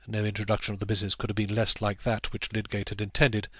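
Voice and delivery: ordinary delivery, nothing marked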